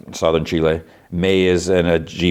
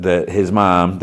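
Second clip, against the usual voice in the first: ordinary talk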